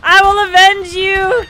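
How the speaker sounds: drawn-out, playful battle cry; mock heroic cry. Player vowing revenge for fallen teammate, mock heroic shout. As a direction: declaim it like a hammed-up avenger.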